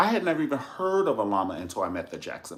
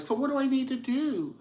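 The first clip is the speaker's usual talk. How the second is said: imitating Janet. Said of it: "She's like".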